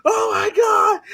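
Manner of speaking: high-pitched hammed-up scream of agony; comic panicked wail; mock screaming. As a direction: scream it high, like a cartoon man on fire. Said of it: "he goes around screaming like".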